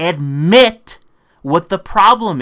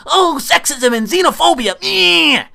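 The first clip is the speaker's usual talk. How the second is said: high, whiny mocking squeal rather than a real scream; 9 semitones higher than usual. Mocking a politician who starts screaming about sexism, high and whiny. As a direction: parody the outrage in a high sneering voice. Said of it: "start screaming".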